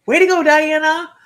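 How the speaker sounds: loud, high cheer through tears; tearful cheer. Real, not acted. Praising viewer Diana, loud tearful cheer. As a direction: cheer her on, choked up.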